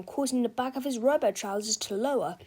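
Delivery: ordinary delivery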